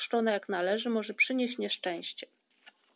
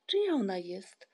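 usual speaking voice

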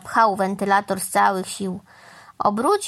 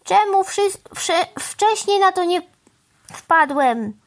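excited stammering; 9 semitones higher than usual. Acted. Crow excited by new idea.

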